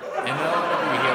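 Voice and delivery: loud laughing while talking. Real, not acted. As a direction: laugh through the words.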